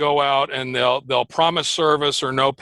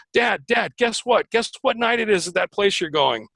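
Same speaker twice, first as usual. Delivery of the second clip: imitating an excited daughter, pitched up; higher-pitched, eager quote of a daughter calling Dad; 6 semitones higher than usual. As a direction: play the daughter bursting with news. Voicing his excited daughter on phone.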